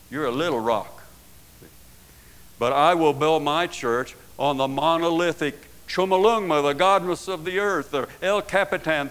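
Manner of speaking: loud, rising preacher's thunder; a preacher hammering home the rock and the pebble; preaching at full force. Acted. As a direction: proclaim it to the back pew. Preaching paraphrase of Jesus.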